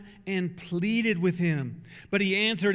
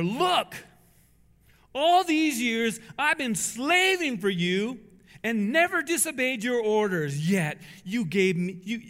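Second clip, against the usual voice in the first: put-on angry older son; 4 dB louder than usual. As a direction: let the resentment burst out. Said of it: "Listen to him".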